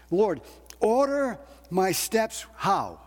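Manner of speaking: ordinary delivery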